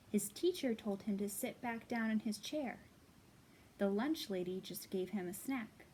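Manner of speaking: put-on lively read-aloud to children; storybook reading. A narrator's reading. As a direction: read it like story time for little kids. Reads a picture book aloud.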